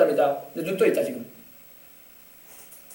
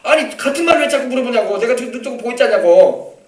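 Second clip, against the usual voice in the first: acted exasperated retort, raised voice; worked-up annoyance; 3 semitones higher, 4 dB louder than usual. Healed man answering repeated questions, worked up and annoyed. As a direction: protest like someone sick of repeating himself. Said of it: "흥분한 거야".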